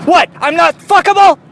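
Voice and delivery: high, loud put-on indignant voice; a rejected guy squealing in offended disbelief; outraged squeak.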